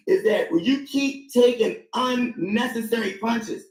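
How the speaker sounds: slow, with unnecessary stretched and weighted; slow down and hit unnecessary; stress on unnecessary. Real, not acted. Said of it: "emphasis on the word unnecessary".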